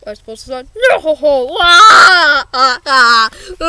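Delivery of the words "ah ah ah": loud hammed-up sobs; mock sobbing. Krabs sobbing over his smashed cash register, hammed-up sobs. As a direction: blubber over the broken register. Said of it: "thought you said robots can't cry".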